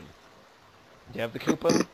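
put-on light Minnesota accent, slow and drawn out; slow Upper Midwest "do you have the coupon?"; a hint of Minnesota. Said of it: "you do a Minnesota accent".

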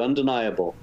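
ordinary delivery

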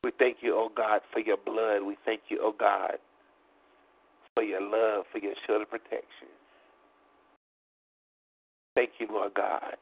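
ordinary delivery

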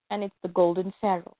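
ordinary delivery, nothing marked

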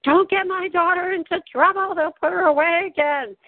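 high-pitched mock motherly plea; retold warning, pitched well up; imitating protective mother. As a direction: plead like mom defending her daughter. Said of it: "she's always telling".

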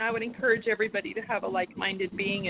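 usual speaking voice

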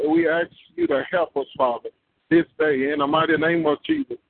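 ordinary delivery

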